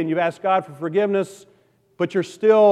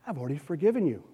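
soft reassuring God; 3 semitones lower, 8 dB quieter, faster than usual. Retold or imitated. God speaking to the sinner.

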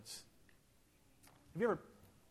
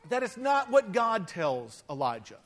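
ordinary delivery

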